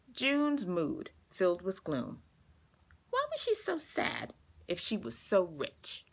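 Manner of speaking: clear, gentle storybook reading; reading to children. A narrator's reading. Teacher reading a picture book to her class, gentle storytelling. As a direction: read it like story time for young kids.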